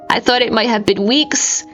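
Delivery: ordinary delivery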